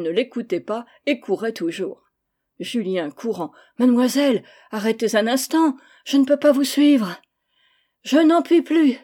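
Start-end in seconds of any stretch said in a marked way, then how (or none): 3.8-9.0 louder and higher, winded from running; breathless cry. A narrator's reading. Tutor gives up the chase, breathless shout. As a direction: cry out, spent and short of breath. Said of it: "cria-t-il une dernière fois en s'arrêtant essoufflé".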